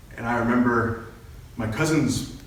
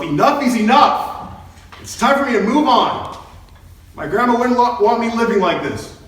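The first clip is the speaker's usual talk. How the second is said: much higher and louder, forceful self-command; determined outburst; 9 semitones higher, 6 dB louder than usual. Acted. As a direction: tell yourself to stop, with force. Resolving to move on.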